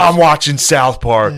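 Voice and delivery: loud, drawn-out defiant shout; mock indignation. Boyfriend brushing off crying to watch TV, mock indignant. Acted callous reply to crying.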